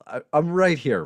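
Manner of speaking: high, loud mock protest; mock indignant protest. Real, not acted. Streamer answering viewer who says he's gone, mock indignant. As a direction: protest loudly as if overlooked.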